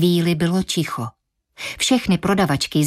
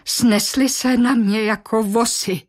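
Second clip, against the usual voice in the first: slow, higher, wounded; slow and hurt; 4 semitones higher than usual. Acted. Anna tells how coworkers swarmed her, slow and hurt. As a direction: spell out the hurt slowly. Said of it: "řekla potom".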